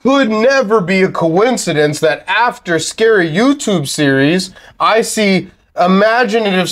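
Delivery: mock disbelief, high and scornful; sarcastic. Real, not acted. Mocking the story's logic.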